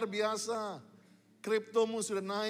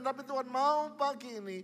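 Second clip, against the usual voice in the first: usual speaking voice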